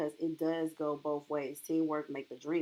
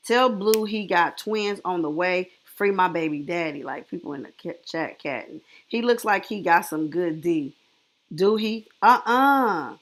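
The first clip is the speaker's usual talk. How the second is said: excited loud; 8 dB louder than usual. Real, not acted. Excited reacting to the live.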